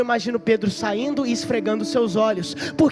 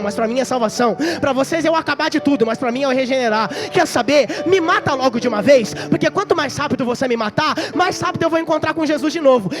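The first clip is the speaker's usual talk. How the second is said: higher, louder rising fervor building to a cry; fervent preaching; 6 semitones higher, 6 dB louder than usual. Acted. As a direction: preach Peter's last words with full force. Peter's climactic preached speech.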